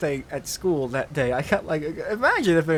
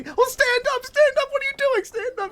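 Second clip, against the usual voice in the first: very high, loud, fast shriek imitation; horrified yell; 5 dB louder than usual. Nurse ordering boy off her chair, mock horrified yell. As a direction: shriek the order in hammed-up horror. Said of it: "as she yells at me".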